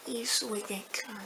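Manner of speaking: fading whisper, almost gone; trailing hushed aside. Real, not acted. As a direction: whisper it and let it die away.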